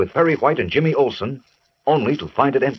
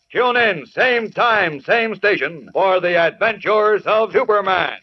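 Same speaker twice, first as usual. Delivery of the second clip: high, dramatic serial announcer; old radio announcer; 7 semitones higher than usual. Acted. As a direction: build the cliffhanger, announce it big. Narrator's cliffhanger sign-off.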